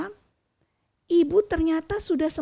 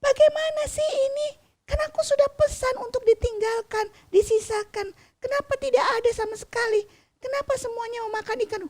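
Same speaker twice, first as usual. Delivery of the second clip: much higher, louder furious father, speeding up at the end; angry father; 13 semitones higher, 3 dB louder, faster than usual. A narrator's reading. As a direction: rant at the family like a tired, angry fisherman. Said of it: "ayah marah-marah". (ayah marah dengan suara tinggi dan keras)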